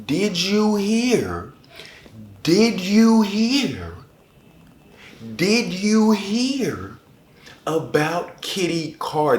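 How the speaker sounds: high, slow sing-song calling out; slow high-pitched tease about a favorite book; sing-song announcement. Acted. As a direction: draw out each word like a teasing diva. Hyping her favorite book pick.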